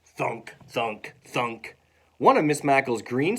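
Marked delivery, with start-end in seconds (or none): 0.1-1.8 short, separated thuds; bouncing thud sounds. Acted. Globe bounces into hallway, dull separate thuds. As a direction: drop each word like a bouncing object.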